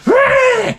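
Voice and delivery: high-pitched mock dog "rah"; sudden dog snarl. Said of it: "the dog is like".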